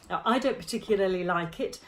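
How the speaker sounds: ordinary talk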